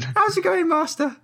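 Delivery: very high, breathy-free squeaky voice; high halfling girl voice.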